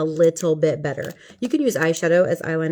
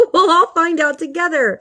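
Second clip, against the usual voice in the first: higher and louder, bursting out of a laugh; laughing exclamation; 10 semitones higher, 6 dB louder than usual. Pretend. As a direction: toss it out gleefully, right after laughing. Laughing, then says it again spookily.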